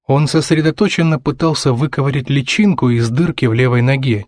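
ordinary delivery, nothing marked

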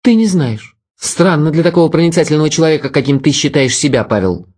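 put-on cold, superior young woman; Vera, cold. A narrator's reading. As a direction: voice her aloof and cutting. Vera's voice, cold.

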